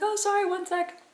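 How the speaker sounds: imitating the driver. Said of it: "He was like".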